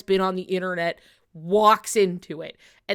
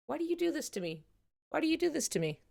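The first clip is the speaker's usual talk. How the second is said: high, soft mock whine; pleading with the game in high soft despair; 7 semitones higher, 8 dB quieter, faster than usual. Real, not acted. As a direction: whimper at the screen in fake anguish. Begging the screen in distress.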